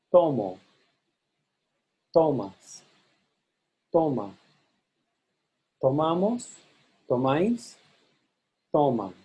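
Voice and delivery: TO stressed in "Tomo, tomas, toma, toman", MA in "Tomamos, tomáis"; shifting stress drill. Shown as a demonstration. Teacher leads repeat after me, MA stressed only in "Tomamos, tomáis". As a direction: separate each form, moving stress to MA only for "Tomamos, tomáis". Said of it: "repeat after me".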